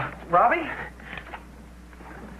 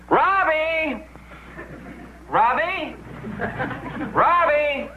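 calling out; 9 semitones higher, much slower than usual. Real, not acted. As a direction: call him from another room. Calling brother through the house.

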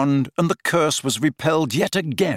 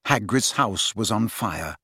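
usual speaking voice

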